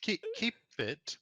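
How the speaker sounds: a startled NPC asking for it back. Acted. NPC reacts to belt being kept.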